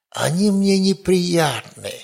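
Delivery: usual speaking voice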